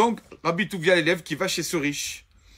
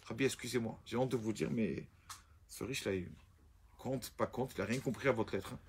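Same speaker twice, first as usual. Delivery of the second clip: much lower and softer, embarrassed; student owning up to his rabbi, hushed and lower; ashamed report; 6 semitones lower, 11 dB quieter than usual. Acted. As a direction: confess the failure, almost under his breath.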